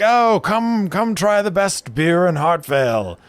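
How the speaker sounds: high, loud market-stall barker voice; calling out to passersby. Acted. Beer seller calling to passing people, loud and high. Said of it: "He is calling out to people".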